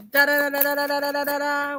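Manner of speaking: sung-out drum roll, da da da; vocal drum roll to build suspense; mouth drum roll. Shown as a demonstration. Host giving herself a drum roll before the draw. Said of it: "give me a drum roll".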